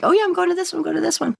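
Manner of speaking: high, excited imitation of people signing up; mock eager con-goer. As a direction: perk up like an eager fan. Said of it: "people just are like".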